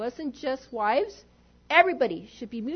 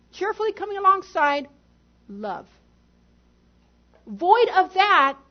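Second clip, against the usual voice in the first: preaching emphasis; 6 semitones higher, 10 dB louder than usual. Real, not acted.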